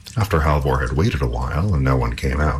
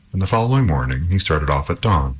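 usual speaking voice

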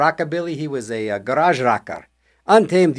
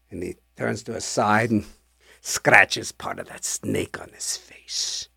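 hushed low narration; 6 semitones lower, 9 dB quieter, slower than usual. A narrator's reading. Creepy story climax.

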